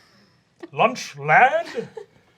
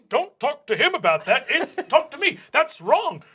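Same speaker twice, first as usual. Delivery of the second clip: higher, louder, eager voice; jealous vice principal; 12 semitones higher, 5 dB louder than usual. Acted. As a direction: butt in to claim the student.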